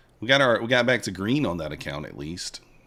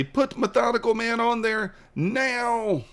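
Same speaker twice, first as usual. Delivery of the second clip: much higher, slow whiny demand; mock demand; 12 semitones higher, slower than usual. Fans he sends to the channel, demanding in a high whiny voice. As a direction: chant it like a petulant fan. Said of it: "go over there and say".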